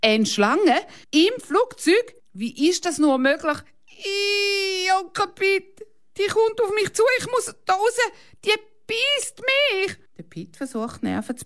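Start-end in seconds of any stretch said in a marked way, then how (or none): 0.0-3.6 alarmed disbelief. A narrator's reading. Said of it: "heiß und kalt". 3.9-10.0 very high crying cries; hysterical terror. A narrator's reading. Girl begs her uncle to get her out, very high and crying. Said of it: "Hanna panics".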